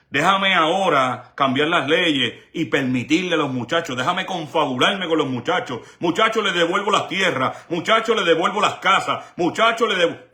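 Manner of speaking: sarcastic impression of false generosity; mock sweet-talker. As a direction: wheedle the boys like a con man. Mocking the church's false promises.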